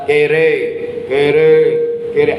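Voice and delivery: drill-style marching count; acted parade-ground call; marching cadence call. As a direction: call the step to marching troops. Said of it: "Bapak gerak jalan nih".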